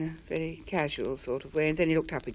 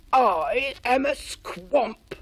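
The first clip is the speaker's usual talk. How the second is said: slow, loud creature voice; squamp voice; 6 semitones higher, 6 dB louder, much slower than usual. A narrator's reading. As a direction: drag out the monster's words. The squamp speaks.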